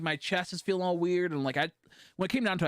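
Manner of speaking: ordinary talk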